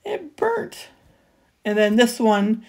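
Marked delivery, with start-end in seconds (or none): 0.0-0.9 high, soft, whiny little lament; imitating her own letdown at the burnt project; mock dismay. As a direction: pout it out like a sulky child. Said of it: "I was just like".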